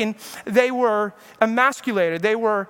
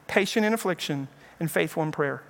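softer and lower, settling down; soft repeat; 6 semitones lower, 6 dB quieter than usual. Shown as a demonstration. Preacher repeats the verse to his flock, softer and lower. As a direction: let the verse sink in quietly. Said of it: "repeats the verse softer".

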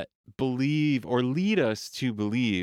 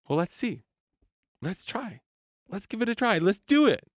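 much higher, an eager curious inner voice; hopeful inner voice; 10 semitones higher, faster than usual. Acted. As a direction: lift it up like a voice inside saying yes. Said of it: "the answer to that question is always".

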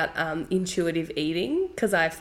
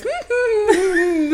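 put-on long high giggle like the toddler at the fridge; imitated toddler giggle; 13 semitones higher, 5 dB louder than usual. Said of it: "'cause she goes".

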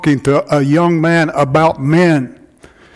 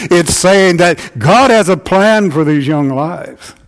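normal talking voice

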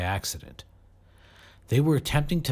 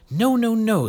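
insistent; 13 semitones higher, 6 dB louder than usual. A narrator's reading. Correcting the others urgently.

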